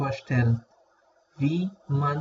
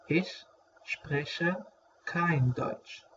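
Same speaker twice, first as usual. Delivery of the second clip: softer, slow repeat with a pause between words; 3 semitones higher, 5 dB quieter, much slower than usual. Shown as a demonstration. Teacher repeating a German phrase for learners, slow and separated. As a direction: break the phrase apart for learners.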